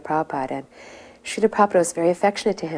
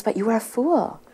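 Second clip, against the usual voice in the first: higher-pitched mock rebuke; retold scolding; 5 semitones higher than usual. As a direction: scold him as the guru, without shouting. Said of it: "getting heavier and heavier with him".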